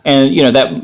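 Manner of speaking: normal talking voice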